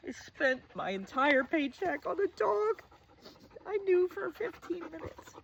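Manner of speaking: high, tight, tearful voice; real tearfulness. Real, not acted. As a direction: voice climbing as tears well up. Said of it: "I'm gonna cry".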